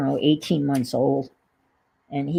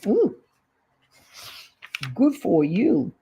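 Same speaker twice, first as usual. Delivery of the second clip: delighted ooh; 2 semitones higher than usual. Real, not acted. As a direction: coo with delight. Delighted at healthy food.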